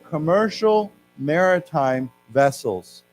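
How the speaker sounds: slow, clear model phrase; slow repeat-after-me. Shown as a demonstration. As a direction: say it slowly for students to copy. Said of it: "why don't we say that together?".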